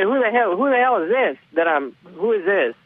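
ordinary talk

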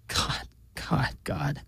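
stunned breathy whisper. A narrator's reading. Jade whispering in shock over the body. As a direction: barely breathe the words.

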